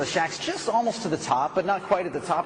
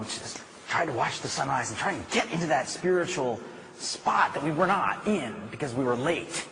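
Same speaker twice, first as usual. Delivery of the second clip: ordinary talk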